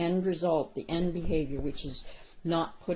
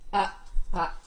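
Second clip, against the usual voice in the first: short sharp warning ah ah; sharp correction; 7 dB louder than usual. Shown as a demonstration. Trainer warning a dog off the leash, firm and sharp. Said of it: "now you're gonna go".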